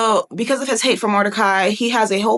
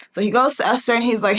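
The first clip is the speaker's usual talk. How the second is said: normal talking voice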